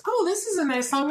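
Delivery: high, innocent take on the fiance; mock fiance. Fiance praises a radio song unaware, lightly mimicked. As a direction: play the unsuspecting fiance. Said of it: "He's like".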